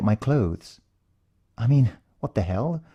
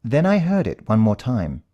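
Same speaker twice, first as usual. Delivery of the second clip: ordinary delivery, nothing marked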